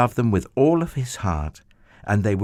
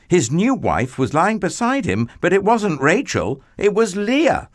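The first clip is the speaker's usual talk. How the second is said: rising higher and louder into a shocked gasp; shocked reveal; 9 semitones higher, 4 dB louder than usual. A narrator's reading. Narrator building to Jacob finding Leah beside him, high and loud. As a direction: build to the horror of it.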